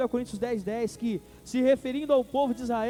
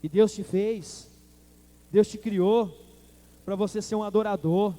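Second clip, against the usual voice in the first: full-force preaching; 4 dB louder than usual. Acted. Preacher proclaims to the congregation, loud and emphatic. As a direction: hammer each phrase home to the church.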